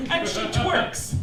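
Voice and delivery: usual speaking voice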